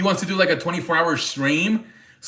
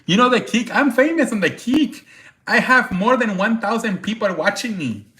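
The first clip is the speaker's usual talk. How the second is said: higher mock Turkish accent, bragging; 7 semitones higher than usual. Mimicking the Turkish streamer bragging about fame, high mock accent. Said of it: "so he does an accent".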